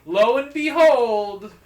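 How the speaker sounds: loud, high, stretched-out proclamation; storyteller's booming, drawn-out reveal; grand dramatic announcement. Real, not acted. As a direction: belt out the big plot twist slowly. Dramatic turn in told story.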